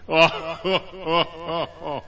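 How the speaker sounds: mocking storybook monster laughter; giant's evil laugh. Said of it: "only laughed and said".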